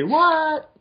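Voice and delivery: loud, very high mock disbelief; playful falsetto. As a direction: squeal it in exaggerated pretend surprise. Joking high-pitched incredulous echo.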